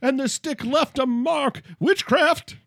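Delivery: hammed-up outrage at a painting; mock witchcraft accuser. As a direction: sputter a mock witch-hunt charge.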